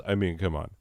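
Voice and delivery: ordinary talk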